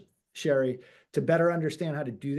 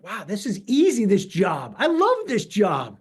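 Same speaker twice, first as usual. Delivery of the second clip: mock thrilled rookie; 3 semitones higher, 5 dB louder, slower than usual. Rookie agent gushing after first signing, slow and delighted. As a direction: savour it like the easiest job on earth. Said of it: "I'm super excited".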